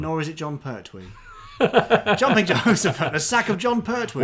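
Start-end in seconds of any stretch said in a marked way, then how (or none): 1.1-2.1 loud burst of laughter; big laugh. Real, not acted. Laughs at the guess. 2.2-4.2 high, loud excited outburst; excited exclamation. Pretend. Exclaiming a silly quote.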